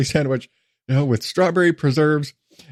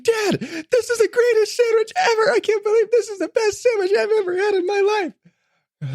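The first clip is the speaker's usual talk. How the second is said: put-on much higher, squealing kid voice; thrilled little boy. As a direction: gush like a four-year-old in heaven. Son praises sandwich.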